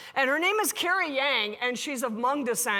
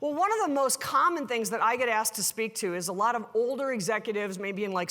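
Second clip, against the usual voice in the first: normal talking voice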